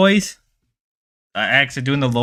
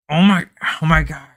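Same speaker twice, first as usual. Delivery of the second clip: usual speaking voice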